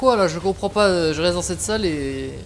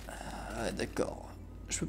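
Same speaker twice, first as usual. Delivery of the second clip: whispered; 8 semitones lower, 18 dB quieter, slower than usual. Real, not acted. Dry reaction, whispered.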